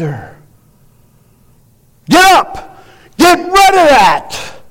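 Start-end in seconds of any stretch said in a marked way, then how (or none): 2.1-4.6 higher, slow shouted order; commanding shout. Acted. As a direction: bark it like a command from above. Preacher voicing God's demand.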